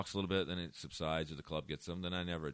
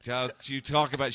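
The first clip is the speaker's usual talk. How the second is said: raised, louder scolding; offended audience member; 6 semitones higher, 9 dB louder than usual. Retold or imitated. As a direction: imitate someone telling off the comic. Acts out offended woman standing up.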